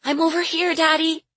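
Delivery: put-on high-pitched little girl calling for Daddy; child crying out. A narrator's reading. Caged girl calls to her missing father, high child's cry. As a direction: call out high and urgent, as a frightened child. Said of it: "she cried in a weak voice".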